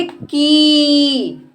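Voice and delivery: की with the vowel held very long. Shown as a demonstration. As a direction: draw out की slowly and steadily. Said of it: "इसके उच्चारण में ज़्यादा समय लगता है". (लंबा खींचकर बोला गया की)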